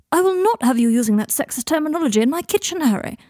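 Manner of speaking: high, emphatic mock outrage; playfully imperious telling-off; mock indignation. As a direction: scold with hammed-up affront. Draco's mock outrage in kitchen banter.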